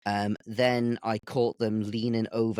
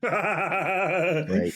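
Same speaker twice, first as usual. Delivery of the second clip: mock slam poet; 8 semitones higher than usual. Mock slam poetry reading.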